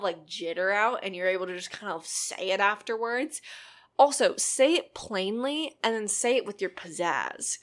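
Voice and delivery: normal talking voice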